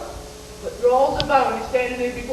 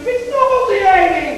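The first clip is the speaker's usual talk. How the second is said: disgusted; 6 semitones higher, 6 dB louder, slower than usual. Real, not acted. Disgusted rant about filth.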